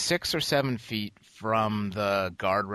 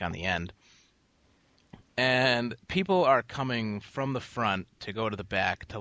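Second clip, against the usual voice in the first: ordinary delivery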